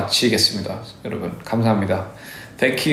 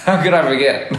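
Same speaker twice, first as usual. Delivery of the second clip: laughing; 4 semitones higher than usual. Real, not acted. Laughs at forgetting Brazil.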